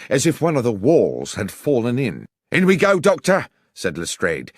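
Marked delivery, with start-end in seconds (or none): 2.5-3.5 high, loud call to charge; loud, urgent command; rallying shout. Acted. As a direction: shout it to launch the raid. Policeman starts the charge.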